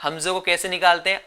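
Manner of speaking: usual speaking voice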